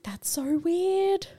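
very high, drawn-out disbelief; weirded-out reaction. Retold or imitated. Podcaster agreeing with co-host about the film, weirded out. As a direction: stretch it out slowly, way up high.

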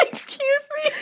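high, helpless giggling protest; real laughing fit, high-pitched. Real, not acted. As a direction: squeak it out through laughter. Fighting a giggle fit while reading.

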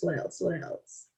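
trailing off to a whisper while thinking; thinking aloud, voice dropping away; fading to a whisper. Real, not acted. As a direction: let it fade into a whisper as you search memory. Searching memory, fading to unvoiced.

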